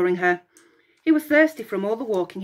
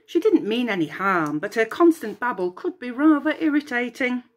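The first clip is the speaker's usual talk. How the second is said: ordinary delivery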